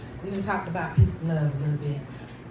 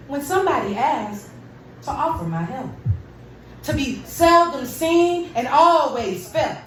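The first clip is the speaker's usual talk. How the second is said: defiant poem; 6 semitones higher than usual. Acted. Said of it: "one of my angry pieces".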